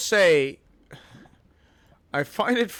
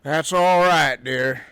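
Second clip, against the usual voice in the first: slow, drawn-out Southern drawl; put-on Southern accent; 3 semitones lower, 3 dB louder, much slower than usual. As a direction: mimic her Southern drawl. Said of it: "I can put on a voice just like yours".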